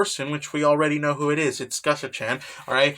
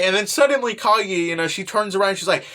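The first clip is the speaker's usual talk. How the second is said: ordinary delivery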